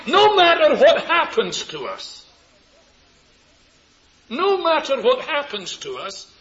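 high, loud, emphatic pulpit delivery; full-force preaching. Real, not acted. Preacher declaring the words of the hymn, high and loud. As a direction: proclaim it loudly to the whole congregation.

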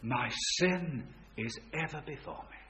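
a king finally giving in, quiet and crushed; broken confession. Acted. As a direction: let the guilt drain the voice. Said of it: "he could bear it no longer".

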